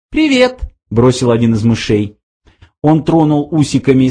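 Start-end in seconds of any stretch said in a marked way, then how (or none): 0.1-0.6 very high little mouse greeting; thin mouse voice. A narrator's reading. Mouse greets the newcomer, in a thin high voice. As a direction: squeak it out high and casual. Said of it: "раздался тонкий голосок".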